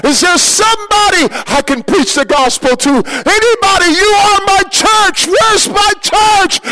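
shouting for a congregation that isn't there; frantic shouting. Retold or imitated. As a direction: call out across the empty field. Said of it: "I began to scream".

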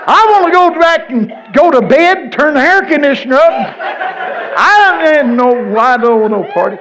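loud, high-pitched holler; mock protest. Preacher protesting he wants bed to party girls, high hollering. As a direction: wail it out in comic exasperation.

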